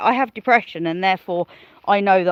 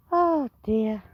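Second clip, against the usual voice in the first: normal talking voice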